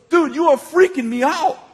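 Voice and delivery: high, loud imitation of a spooked man; mock freaked-out cry. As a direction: exclaim it as if totally unnerved.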